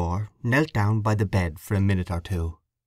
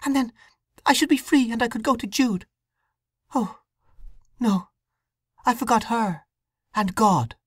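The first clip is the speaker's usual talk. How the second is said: put-on distressed young woman, voice raised higher; a troubled young wife confessing; higher, anxious young woman's voice; young woman Sue; 14 semitones higher than usual. Sue's guilty hope.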